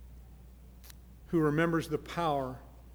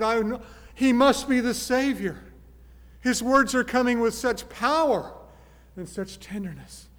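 awed listener; 7 semitones higher, 8 dB louder than usual. Acted. Crowd voiced hearing Jesus preach.

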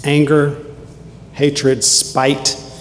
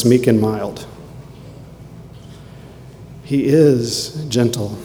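ordinary talk

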